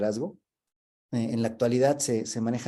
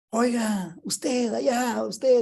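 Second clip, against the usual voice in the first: high, drawn-out shout from a balloon; mock-holler down to someone far below; hailing a distant stranger, voice pitched way up; calling out from afar; 11 semitones higher, much slower than usual. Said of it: "le gritan desde el globo".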